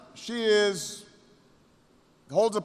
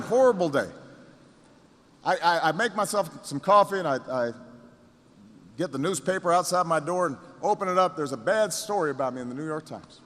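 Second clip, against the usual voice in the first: ordinary talk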